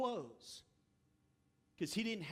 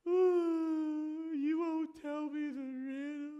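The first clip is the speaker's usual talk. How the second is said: high, loud fake sobbing plea; mock crying; 7 semitones higher, 5 dB louder, slower than usual. Wife pleading through fake tears. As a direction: whine it through put-on tears. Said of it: "she goes around and gets the big tears".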